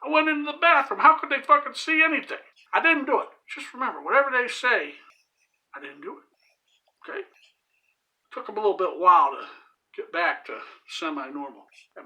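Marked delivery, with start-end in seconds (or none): 0.0-3.3 high, raised protest; insistent denial. Retold or imitated. Retold denial to crying Kyle. 5.8-7.3 very soft, slow whispered denial; sly whisper. Pretend. Sly secret denial to listeners.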